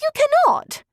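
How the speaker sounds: horrified sister forbidding the match; shrill objection. A narrator's reading. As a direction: protest in a piercing high voice. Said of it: "Miss Bingley exclaimed".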